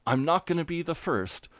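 mock protest, pitched well up; high-pitched mock declaration of refusal; imitated indignant character. Quoting Mark refusing to go first, mock indignant. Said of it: "he's like".

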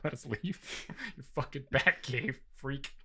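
quiet, giggling mock contractor; barely holding back laughter; laughing through words.

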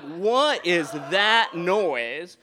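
loud, slow retold disbelief; baffled alarm. Storyteller reacting to strange grumbling downstairs, loud and baffled. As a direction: draw it out, loudly baffled.

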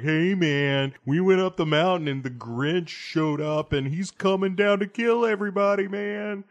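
put-on stoner kid voice. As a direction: play the panicky stoner kid, high and loose. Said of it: "where they're like".

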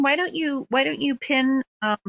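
usual speaking voice

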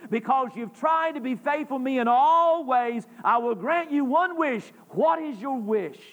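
loud booming voice of God; booming arrogant alien overlord. Acted. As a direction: boom it down from the sky. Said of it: "in a booming voice".

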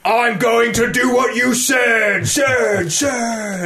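high, slow shouted orc echo; orc captain voice. Retold or imitated. Friendly orc captain pledging loyalty, high slow shout. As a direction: boom it out like a hammy orc minion. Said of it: "and they're like".

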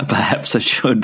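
chuckling through the words; amused laugh at a joke. Real, not acted.

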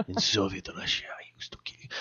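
soft, breathless Soviet threat; mock menacing whisper. Soviet Russia joke, whispered.

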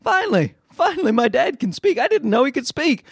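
put-on high, surprised baby voice; voicing the baby. Voicing his baby son, amazed that dad finally talks. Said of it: "He's like".

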